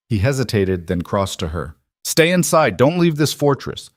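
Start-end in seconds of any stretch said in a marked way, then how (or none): 2.0-3.9 raised, urgent command; no-argument order. A narrator's reading. King orders her to stay in the fortress, no-argument order. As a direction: give a firm order pitched well up. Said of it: "His voice left no room for argument".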